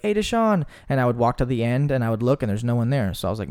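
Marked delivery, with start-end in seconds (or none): 0.0-0.7 high, bright call across the aisles; calling out to a friend. Retold or imitated. Coworker calling to a friend across the store, high and carrying. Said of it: "So I'd be like".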